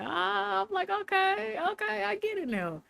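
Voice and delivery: acted-out epiphany. Recalling an epiphany about old lessons, mock sudden realization. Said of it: "I had an epiphany".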